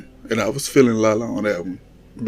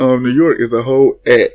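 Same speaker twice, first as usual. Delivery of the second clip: ordinary talk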